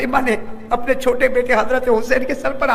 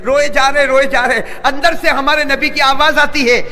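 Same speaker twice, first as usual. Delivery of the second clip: loud preaching; 5 semitones higher, 6 dB louder than usual. Acted. Sermon climax on weeping.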